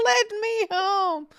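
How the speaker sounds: high, slow echo of a wail; drawn-out, hammed-up plea; mock shouting. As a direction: playfully imitate a desperate cry. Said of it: "driving around my little village shouting".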